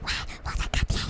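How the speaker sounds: unvoiced whisper. Telling a phone user off in a cinema, whispered. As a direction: hush them without a voice. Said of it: "你会很小声说".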